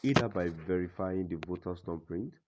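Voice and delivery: ordinary delivery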